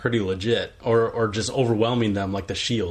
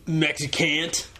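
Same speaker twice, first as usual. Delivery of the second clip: high-pitched mock taunt; hammed-up bully squaring up; bar heckler; 6 semitones higher than usual. Co-host as the bar antagonist to the wrestler, mock taunting. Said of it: "I'd be like".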